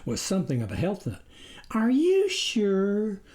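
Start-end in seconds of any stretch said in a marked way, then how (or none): 1.7-3.2 doubtful wife. A narrator's reading.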